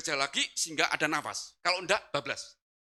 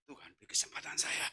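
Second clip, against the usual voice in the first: low whispered plea; prayer in the heart; 13 semitones lower, 9 dB quieter than usual. Retold or imitated. Driver afraid to pray aloud begs God, whisper. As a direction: whisper it inwardly, very soft. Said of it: "hanya doa dalam hati".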